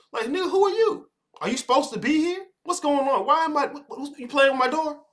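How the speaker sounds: high, baffled questions; incredulous homeowner. Retold or imitated. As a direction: sound thrown by a stranger at your door. Acting homeowner confronting visitor.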